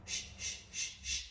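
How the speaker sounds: soft unvoiced "shh" rustles; shushing through grass. A narrator's reading. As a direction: hush like paws in long grass. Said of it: "she goes".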